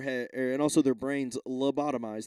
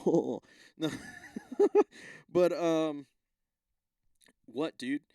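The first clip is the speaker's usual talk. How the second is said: normal talking voice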